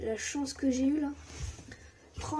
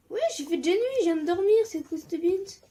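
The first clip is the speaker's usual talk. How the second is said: higher, louder annoyed outburst; indignant outburst; 7 semitones higher, 8 dB louder than usual. Real, not acted. Gamer annoyed the night came back, loud indignant protest.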